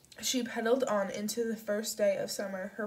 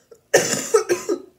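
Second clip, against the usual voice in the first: wet choking cough; 9 semitones higher, 13 dB louder than usual. A narrator's reading. Old man in distress coughs.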